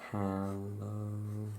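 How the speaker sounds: ordinary delivery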